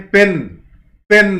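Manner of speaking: पेन said as one unbroken syllable; one-syllable demonstration. Shown as a demonstration. Teacher showing students one syllable, पेन in one beat. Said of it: "आप इसे बोलकर देखें तो आपको दिखेगा एक सिलेबल".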